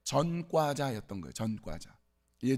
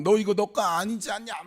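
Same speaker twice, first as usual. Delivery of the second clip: mock confrontation, raised pitch; accusing challenge; 5 semitones higher, 4 dB louder than usual. Bystander challenging a man cutting a bike chain, accusing. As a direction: imitate a bystander confronting someone, higher. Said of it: "막 이렇게 따졌다는 거예요".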